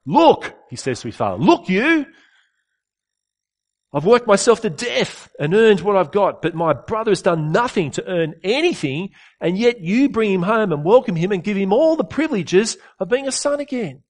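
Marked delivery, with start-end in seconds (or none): 0.0-0.5 a much higher, louder "Look" in disgust; contemptuous look. Retold or imitated. Elder son rebuking his father, loud contemptuous cry. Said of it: "shows contempt to his father and disgust". 1.4-14.0 higher, louder bitter complaint; resentful contempt. Retold or imitated. Elder son complaining to his father, higher louder resentment. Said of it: "shows contempt to his father and disgust".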